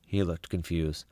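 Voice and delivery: plain reading voice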